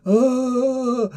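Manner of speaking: high-pitched drawn-out "ah"; mad wail. Acted. Host acting out crazed Baldur lying there, high mad wail. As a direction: imitate a madman's high, sustained cry.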